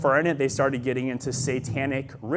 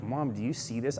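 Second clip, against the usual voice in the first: low, soft retold aside to mom; understated, frightened disbelief; hushed disbelief; 5 semitones lower, 9 dB quieter than usual. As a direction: check with mom under your voice. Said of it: "I'm in disbelief".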